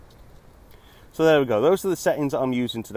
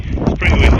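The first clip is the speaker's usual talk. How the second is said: louder, raised over gusts; shouting over wind; 3 semitones higher, 9 dB louder than usual. Real, not acted. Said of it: "I'll just unplug the microphone".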